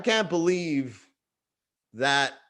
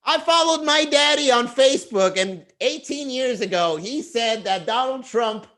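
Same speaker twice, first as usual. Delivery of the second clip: put-on high, laughing kid voice; mock the kid reading daddy's post; future child; 7 semitones higher than usual. Future child laughing at dad's old post, high kid voice.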